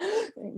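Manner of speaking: imitated sharp gasp of fright; quick, high scared intake of breath; startled gasp. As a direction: mimic someone caught off guard, gasping. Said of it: "got scared".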